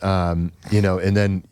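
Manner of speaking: ordinary delivery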